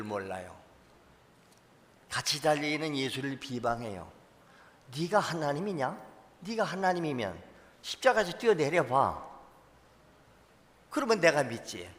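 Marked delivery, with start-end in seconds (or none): 5.0-11.9 higher, sneering taunt at Jesus; mocking thief. The left-hand thief taunts Jesus on the cross, sneering. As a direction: jeer at him as if daring him to jump. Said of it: "조롱해요".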